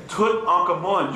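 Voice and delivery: Tutankhamun slowly as Tut-Ankh-Amun; syllables pronounced. Shown as a demonstration. Teacher demonstrating the name, slow syllable-by-syllable Tutankhamun. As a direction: give every part of Tutankhamun its full vowel, slowly. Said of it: "You need to pronounce your syllables".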